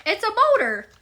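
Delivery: a hint of a cartoon voice; lightly voiced Patrick. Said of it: "we're mimicking SpongeBob".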